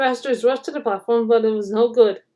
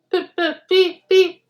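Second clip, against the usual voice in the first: high whistled beeps; engine whistle; 7 semitones higher, slower than usual. A narrator's reading. Edward the engine whistling to the passengers. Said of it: "whistled Edward".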